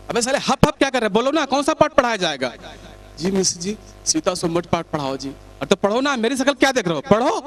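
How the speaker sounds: scolding shout. Acted. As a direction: bark at the class like an angry teacher. Teacher scolding pupils loudly.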